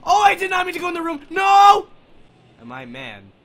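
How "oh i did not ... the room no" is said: fast frantic yelling; panicked shout. Real, not acted. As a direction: yell it in a panic. Scared player caught in horror game.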